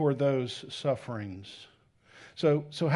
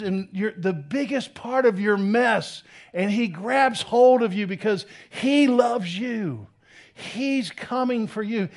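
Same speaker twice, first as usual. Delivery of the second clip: emphatic preaching; 8 semitones higher, 5 dB louder than usual. Acted. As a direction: preach it with conviction. Preaching about God's grabbing love.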